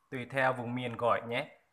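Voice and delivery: ordinary talk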